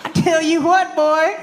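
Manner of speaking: high Texas twang; hammed-up cowboy, laughing. Cowboy voice continues.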